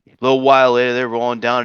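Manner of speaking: usual speaking voice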